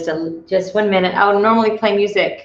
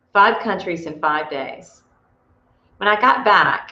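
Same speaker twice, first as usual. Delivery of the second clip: ordinary talk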